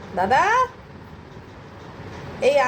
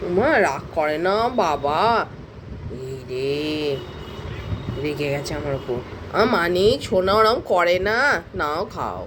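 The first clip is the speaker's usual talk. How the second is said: calm the pet down gently; soothing coo; 8 semitones lower than usual. Real, not acted.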